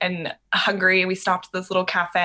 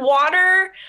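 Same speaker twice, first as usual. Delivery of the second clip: high pleading guess; 12 semitones higher, 5 dB louder than usual. Retold or imitated.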